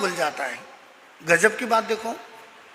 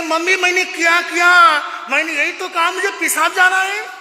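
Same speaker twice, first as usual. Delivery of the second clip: lightly voiced hurt child; 6 semitones higher, 3 dB louder than usual. Child protests the slap.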